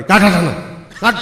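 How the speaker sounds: sudden loud, high yell of command; forceful shout. Retold or imitated. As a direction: roar the order with full force. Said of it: "heavy જોશથી રાડ પાડી". (જોરદાર રાડ પાડીને હુકમ)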